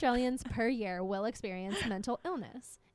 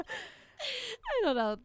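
laughing; 14 semitones higher, 4 dB louder, much slower than usual. Real, not acted. Laughing drawn-out reply.

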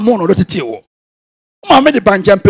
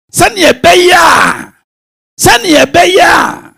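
slow, high, shouted preaching; 7 semitones higher, much slower than usual. Real, not acted. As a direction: drive the point home slowly.

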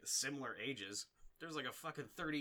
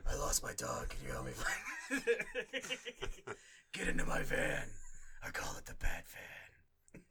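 loud, strained creepy lure; creepy Batman voice; 13 semitones higher, 7 dB louder than usual. Acted. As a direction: call out like a shady stranger. Creepy Batman luring a kid.

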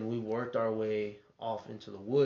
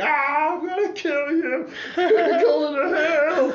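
much higher, louder demonic shriek, slowing at the end; devil voice; 12 dB louder, slower than usual. Retold or imitated. Uncle playing the devil, taunting Billy from the wheel. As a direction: screech it like a possessed devil. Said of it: "he turned into the devil for him".

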